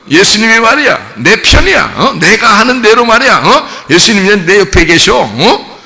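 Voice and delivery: high, hammed-up boasting; mock self-important swagger, throwing his weight around; swaggering show-off. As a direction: brag like a puffed-up disciple. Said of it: "엄청나게 자기 힘 좀 과시한 거죠".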